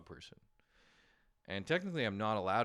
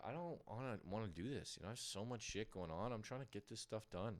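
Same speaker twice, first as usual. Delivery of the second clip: softer, grumbling to himself; mild mumbled reluctance; whiny self-quote; 8 dB quieter than usual. Retold or imitated. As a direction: moan it quietly like a tired slacker. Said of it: "And I was like".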